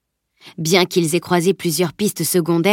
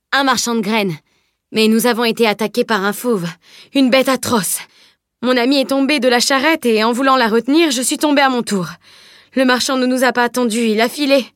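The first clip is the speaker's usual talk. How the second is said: put-on higher, earnest boyish account; garçon conteur; 5 semitones higher than usual. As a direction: spin the misadventure convincingly. (garçon qui raconte son malheur avec conviction)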